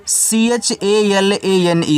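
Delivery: slow letter-by-letter spelling; teacher spelling out a word for students. Shown as a demonstration. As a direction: spell out each letter slowly and deliberately. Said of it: "इसको पढ़ते हैं चलाने".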